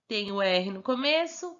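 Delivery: ordinary delivery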